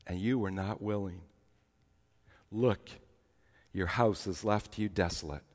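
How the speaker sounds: preacher reading Jesus' lament over Jerusalem, softly; mournful scripture reading. Acted. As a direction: let the lament sink, softly. Reads Jesus' lament aloud.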